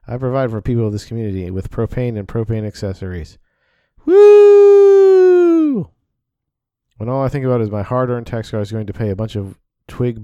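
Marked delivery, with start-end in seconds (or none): 4.0-5.9 a high, loud, wild whoop; whooping holler. Acted. Whooping loudly while the mother lectures the bureaucrat. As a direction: let out a big whoop of excitement.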